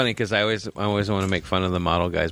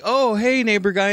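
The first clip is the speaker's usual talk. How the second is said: high, louder flirty greeting; flirty squeal; 14 semitones higher, 4 dB louder than usual. Retold or imitated. Women greeting their big neighbor, high flirty squeal. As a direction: squeal it like eager women spotting a friend. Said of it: "and were like".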